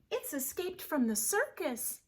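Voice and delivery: an excited mother bear; Missus Blair. Acted. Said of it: "said Missus Blair".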